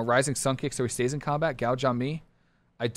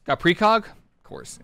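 ordinary talk, nothing marked

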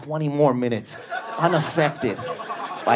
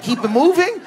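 high disbelieving question; incredulous; 5 dB louder than usual. Retold or imitated. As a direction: repeat it in shock. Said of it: "I go".

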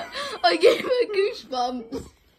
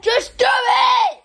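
loud, drawn-out urging shout; 5 semitones higher, 8 dB louder, slower than usual. Real, not acted. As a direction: yell at her to get on with it. Urging friend to slap.

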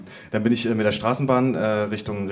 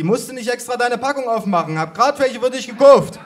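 put-on high old-lady voice growing loud; eager grandma; 12 semitones higher, 7 dB louder than usual. A narrator's reading. Grandma bringing a huge bowl of pralines, high eager calling. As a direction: offer it high, building to a shout.

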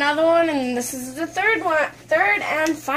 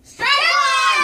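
umpire shout; 9 semitones higher than usual. Pretend. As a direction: bellow it like an umpire. Umpire call in game.

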